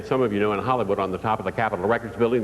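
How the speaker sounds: ordinary delivery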